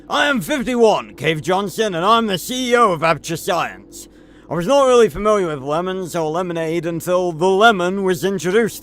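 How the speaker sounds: Cave Johnson voice. Retold or imitated. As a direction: proclaim it like a brash company founder. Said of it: "I'm kind of doing Harry one oh one U K's version".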